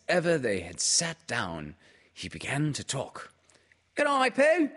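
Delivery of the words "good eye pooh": put-on much higher, squeaky child's voice; high, eager squeaky greeting; squeaky little Roo. A narrator's reading. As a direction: squeak it out like an excited little kangaroo. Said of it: "he squeaked".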